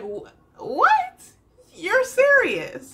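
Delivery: very high, loud mock-shocked mom; drawn-out, hammed-up incredulity; stunned disbelief. Mom staring at her son as at a stranger, mock disbelief. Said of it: "looking at him like she's looking at somebody she ain't never seen before".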